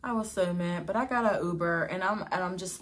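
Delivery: ordinary delivery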